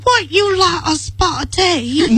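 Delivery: high, slow mock-British teatime voice; mock English accent. Daughter teasing her mother in an English accent. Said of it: "my youngest daughter will walk in and go".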